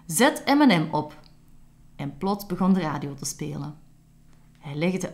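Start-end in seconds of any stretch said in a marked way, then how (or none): 0.1-1.2 louder, higher command to the house; calling out. Acted. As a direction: call out to the smart house. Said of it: "Camille riep, riep opeens". (Camille roept luid een bevel naar het huis)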